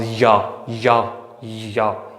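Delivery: "या" with a clear consonant y, repeated slowly; consonant Y sound. Shown as a demonstration. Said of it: "वाई जो है वो अपनी consonant आवाज़". ("या" में य की व्यंजन आवाज़ धीरे-धीरे)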